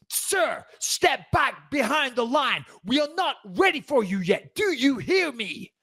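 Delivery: high-pitched, drawn-out officious orders; mean, nasty guard. Retold or imitated. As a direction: imitate a nasty woman guard scolding a passenger, slowly. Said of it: "really mean and nasty with it".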